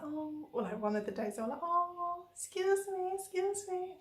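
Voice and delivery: put-on high-pitched little-kid voice, unaccented; imitating little girls. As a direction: pipe up like a small child calling out. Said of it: "I can't do the accent".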